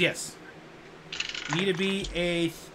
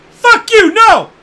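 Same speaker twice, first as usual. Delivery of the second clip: high, loud terrified shriek; panicked scream; 13 dB louder, slower than usual. Acted. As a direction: scream like you've seen a dragon. GM voices Pete panicking at dragon.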